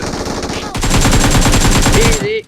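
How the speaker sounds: sudden high yell; sharp alarmed shout. Real, not acted. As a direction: yell to warn the squad. Spotting an enemy suddenly.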